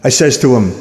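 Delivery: ordinary talk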